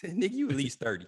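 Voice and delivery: high mock scoffing dad. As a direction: scoff at the grown boyfriend. Dad mocking boyfriend's age.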